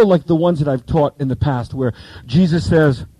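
normal talking voice

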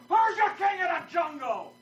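high strained lion voice, far from his own; cartoon lion demanding an answer; bullying lion. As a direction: puff up like a swaggering lion.

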